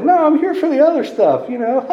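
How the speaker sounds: squeaky, innocent denial; mock innocent. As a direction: play the naive kid brushing it off. Teen denying alcoholism to counselors.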